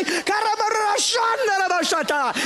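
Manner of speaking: high shouted speaking in tongues; high shouting tongues. Retold or imitated. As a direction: shout tongues through the red light. Driver shouting in tongues at light.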